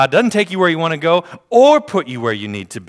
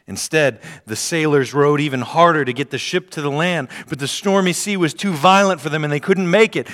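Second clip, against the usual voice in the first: ordinary delivery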